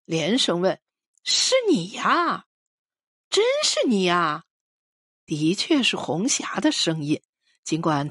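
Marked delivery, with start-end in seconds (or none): 1.2-4.4 can hardly believe who is calling; surprised exclamation. A narrator's reading. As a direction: narrator acting out delighted recognition on the phone. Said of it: "听到那边啊的惊叫一声，连声问".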